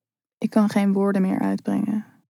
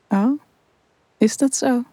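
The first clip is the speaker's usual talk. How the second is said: naughty smile; 4 semitones higher than usual. A narrator's reading. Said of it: "met een ondeugende glimlach".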